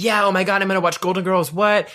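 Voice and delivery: high-pitched gush of a new fan; mock excitement. Telling cohost about getting into a show, excitedly.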